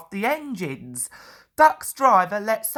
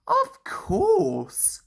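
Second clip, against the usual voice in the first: high, friendly famous engine; City of Truro voice; 10 semitones higher than usual. A narrator's reading. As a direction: give it a bright proud smile. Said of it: "smiled the famous engine".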